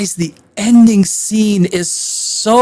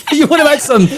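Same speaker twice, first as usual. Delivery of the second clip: laughing; 8 semitones higher than usual. Real, not acted. Reviewer laughing at the casting.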